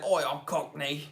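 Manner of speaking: reading out in a lightly put-on Cockney accent; a hint of Cockney at an ordinary pitch. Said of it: "Penny said".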